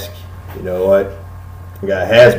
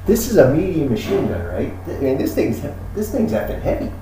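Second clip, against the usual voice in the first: high, soft, hyped product pitch; hushed gun dealer; 8 semitones higher, 4 dB quieter than usual. Acted. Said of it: "he's like".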